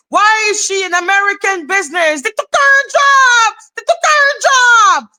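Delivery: very high-pitched, hammed-up screechy complaint; mock outraged hater. Streamer mimicking hate for the foreigner, mock hysterical shouting. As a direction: build to a mock shriek of outrage.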